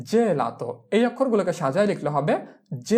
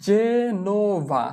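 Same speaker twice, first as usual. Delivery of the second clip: slow sounding-out; 3 semitones higher, much slower than usual. Shown as a demonstration. Teacher showing learners how to write Genova, slowly sounded out. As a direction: stretch Genova into three long syllables, je-no-va. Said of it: "জেনোভাকে আমরা কিভাবে লিখব".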